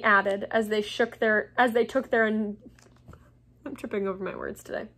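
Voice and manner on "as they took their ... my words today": ordinary delivery, nothing marked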